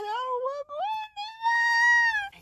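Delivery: very high, soft drawn-out whimper; fake-cry in a thin high voice; mock wailing. Adam banished by God, mock wailing.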